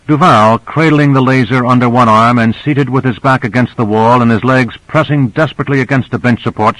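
ordinary delivery, nothing marked

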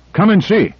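high, eager call to the others; excited call. A narrator's reading. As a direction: urge them up the ladder. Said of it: "he cried".

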